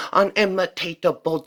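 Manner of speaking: usual speaking voice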